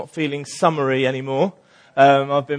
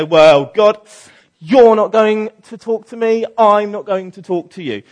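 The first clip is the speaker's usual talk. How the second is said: sulky; 6 semitones higher, 5 dB louder than usual. Retold or imitated. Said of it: "You've got the sulker".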